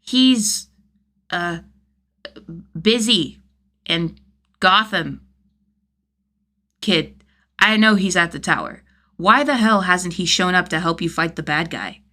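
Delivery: put-on teasing, exasperated older brother; Jason voiced. Jason's dialogue, fiction.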